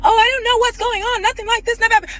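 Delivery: high, put-on whiny voice of innocence; mock him playing dumb in a squeaky voice; mocking Dennis. [name] mimics Dennis pretending innocence, in a high whiny voice. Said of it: "you acting like".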